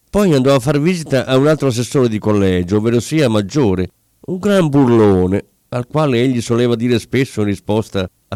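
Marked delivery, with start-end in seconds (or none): none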